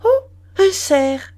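high, loud, slow exclamation; wife spotting a deer from the train, crying out; cried out. A narrator's reading. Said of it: "Elle cria".

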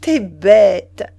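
loud, playful outburst; amused exclamation. A narrator's reading. Wife teasing her husband's pupil act, loud amused exclamation. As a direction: burst out loudly, much amused. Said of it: "Elle s'écria, s'amusant beaucoup".

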